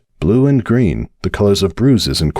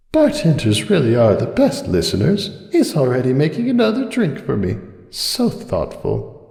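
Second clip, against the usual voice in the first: Cupcake musing; 7 semitones higher than usual. Acted. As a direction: drift off fondly into a hush. Cupcake's tipsy thoughts.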